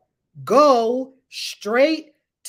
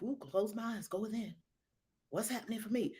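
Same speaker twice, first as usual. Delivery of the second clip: very soft, lower musing; inward self-talk; 4 semitones lower, 16 dB quieter, faster than usual. Acted. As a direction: turn inward, barely voiced aloud. Acts out looking within.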